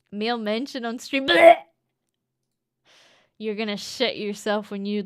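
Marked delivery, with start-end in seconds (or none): 1.3-1.6 disgusted retch. Acted. Male mention on stream.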